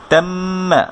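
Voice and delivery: তাম্মা with the doubled m held in the nose; slow তাম্মা, hum the mm; held nasal ghunna. Shown as a demonstration. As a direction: stretch the mm of তাম্মা through the nose. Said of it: "গুন্না মানে হচ্ছে একটু নাকে ধরে".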